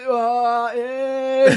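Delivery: loud, drawn-out mock dismay; troubled groaning. Cohost acting out a club for people stuck for topics, mock-troubled wailing. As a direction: groan as if badly stumped, hammed up. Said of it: "困ってる会みたいな".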